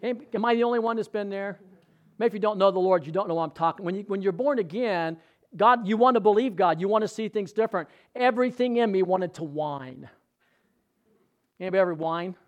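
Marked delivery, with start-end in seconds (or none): none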